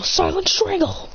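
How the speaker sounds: high, slow technique cry; anime-style move announcement; attack call. Acted. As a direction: stretch out the move name high.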